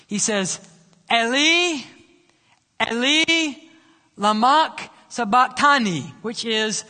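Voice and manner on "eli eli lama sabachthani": crying out high and loud in anguish. Acted. Jesus on the cross crying out loud to God. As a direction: call out to God at full voice. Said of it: "cries out with a loud voice".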